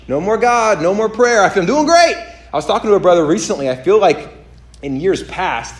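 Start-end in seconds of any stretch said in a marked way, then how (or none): 1.6-2.2 high, loud, breezy boast; imitating someone cheerfully overconfident; mock self-satisfaction. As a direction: crow it in a put-on high voice. Acts out self-satisfied churchgoer dropping prayer.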